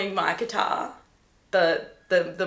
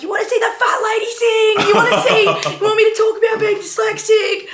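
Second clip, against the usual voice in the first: much higher, louder, laughing rant; mock shout at audience; 14 semitones higher, 7 dB louder than usual. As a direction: taunt the crowd in a giddy yell. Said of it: "I will just shout at people in the audience".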